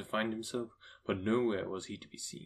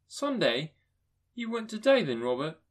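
higher and louder, taken aback; a wife startled by the news; wife's surprised question; 8 semitones higher, 6 dB louder than usual. Acted. Wife to Bob, surprised and pained.

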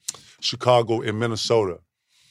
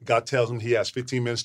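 normal talking voice

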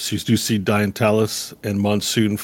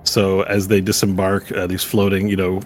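usual speaking voice

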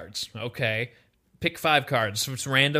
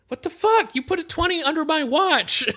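much higher, louder mock outburst; imitating shocked kid; 5 dB louder than usual. Kid finds the bill under his watch, high shocked yell. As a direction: squeal the discovery like a stunned kid.

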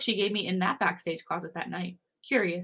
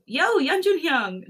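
drawn-out, high, loud greeting; hearty shouted hello, pitched up; calling out; 8 semitones higher, 7 dB louder, much slower than usual. Acted. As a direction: call out to a friend, stretching the words. Bandmate greets him in story.

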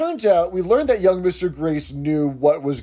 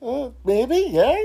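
doubtful; 10 semitones higher, slower than usual. Retold or imitated. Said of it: "a questionable face, like".

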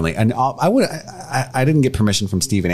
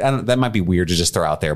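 ordinary delivery

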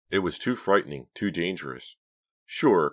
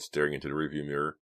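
usual speaking voice

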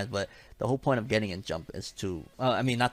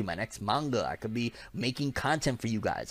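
usual speaking voice

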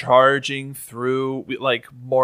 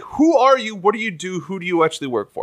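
squeaky mock grilling, speeding up at the end; mock interrogation; 6 semitones higher, faster than usual. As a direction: fire questions like a suspicious interrogator. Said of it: "And it's like".

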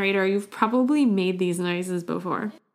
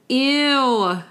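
a higher, louder, drawn-out ew; disgusted ew; 5 semitones higher, 5 dB louder than usual. Shown as a demonstration. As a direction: pull back with ew. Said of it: "that's disgusting".

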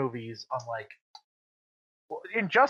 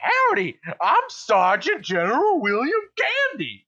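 loud, high-pitched mock Texan holler; Texas accent; 8 semitones higher, 6 dB louder, slower than usual. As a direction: belt out an over-the-top Texas drawl. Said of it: "Arnold Schwarzenegger in a Texas accent".

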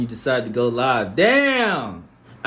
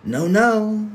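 1 ringtone no; 6 semitones higher than usual. Retold or imitated. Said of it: "I have your voice as a ringtone".

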